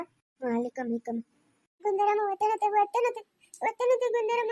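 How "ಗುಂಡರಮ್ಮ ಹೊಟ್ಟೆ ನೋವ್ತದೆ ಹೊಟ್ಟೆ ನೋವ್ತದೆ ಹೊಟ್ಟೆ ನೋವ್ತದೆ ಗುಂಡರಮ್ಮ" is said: high, pained wail; tearful, higher-pitched pleading; crying in labour. Acted. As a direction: cry out repeatedly through the pain. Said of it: "ಏನಕ್ಕೆ ಇವಾಗ ನೀನ್ ಅಳ್ತಾ ಇರೋದು".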